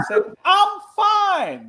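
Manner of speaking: very high, loud mock wail; imitated crying. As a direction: insist through hammed-up tears. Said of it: "and he starts crying".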